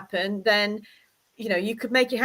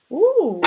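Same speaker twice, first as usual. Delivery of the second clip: higher, louder swooping ooh; intrigued ooh; 6 semitones higher, 5 dB louder than usual. Real, not acted. Reader reacting to the cards, swooping intrigued ooh. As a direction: let out a rising-and-falling ooh. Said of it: "good sound effects though".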